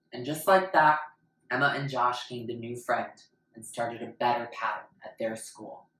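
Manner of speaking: usual speaking voice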